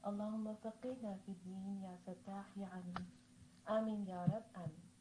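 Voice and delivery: measured, solemn Arabic supplication, drawn out; opening prayer recitation. Acted. Teacher opening her online class, reciting prayers solemnly. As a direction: recite the opening duas with reverence.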